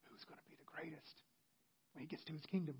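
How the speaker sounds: whispered, conspiratorial question. Retold or imitated. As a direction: whisper it like disciples plotting. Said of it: "What were they whispering about?".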